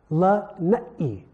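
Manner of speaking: Lanai said with a catch, la-'nai, a stop before the second syllable; glottal stop. Shown as a demonstration. Teacher showing the Hawaiian glottal stop in Lanai. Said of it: "You hear that uh-oh sound in there?".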